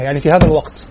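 normal talking voice

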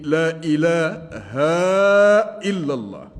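final "ha" of "إله" held very long; long chant-like hold on the end of "إله"; lengthened haa. Shown as a demonstration. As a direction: stretch "إله" into "ilaahaaa", then drop for "إلا الله". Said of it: "مد الهاء في قوله".

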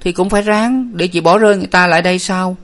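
high-pitched plaintive complaint; plaintive younger sister; whining moan. A narrator's reading. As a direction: whine that you'll have to keep going. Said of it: "Hà rên rỉ".

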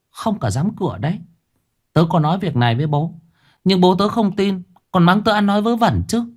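put-on high, eager young girl telling a secret; a frightened little girl, voice pitched high. Girl Thư tells frightened story.

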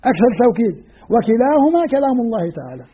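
ordinary delivery

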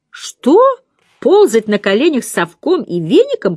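high, loud disbelieving "Что?" then an indignant question; outraged astonishment at the very idea; astonished. A narrator's reading. Young maid astonished at being told to scrub floors, loud high disbelief. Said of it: "поразилась Черри".

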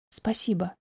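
low, soft thanks; whispered. A narrator's reading. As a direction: murmur it softly, almost whispering. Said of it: "прошептала я".

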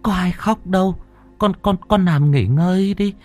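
holding back tears. Acted. Grandmother comforting her sick grandson, holding back tears. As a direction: comfort the boy while fighting tears. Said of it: "nén lại cơn xúc động".

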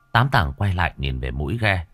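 plain reading voice

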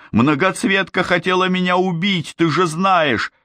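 very high, pleading self-defence; desperate protest. A narrator's reading. Boy excuses betrayal to girl, high desperate protest. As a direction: plead your case in a high voice.

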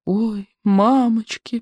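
slow, drawn-out moan; groaning. A narrator's reading. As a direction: groan it out in dread. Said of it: "простонал Рыбьенок".

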